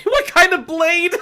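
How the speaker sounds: high, loud, drawn-out disbelief; incredulous laughing repeat. Real, not acted. Reader baffled by Gaia's odd question, shrieking incredulously. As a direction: squawk the question slowly through laughter.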